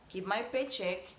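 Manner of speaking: normal talking voice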